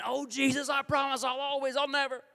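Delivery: high, loud, hammed-up pledge; fervent vow. Believer swearing to Jesus after slipping, over-fervently. Acting out repeated promises to Jesus.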